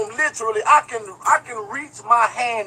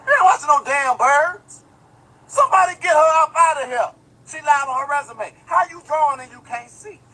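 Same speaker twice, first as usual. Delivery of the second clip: mock outrage; 7 semitones higher, 3 dB louder than usual. Mock outrage at sketch artist.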